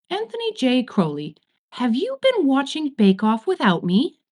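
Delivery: high, loud indignant scold; scolding full name. Acted. Angel scolds Crowley for watching alone, indignant.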